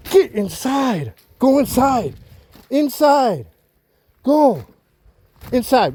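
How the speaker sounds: high, loud, drawn-out orders with falling ends; furious high yell at a dog; angry shouted command. Real, not acted. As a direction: send the dog indoors, really mad. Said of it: "I'm mad".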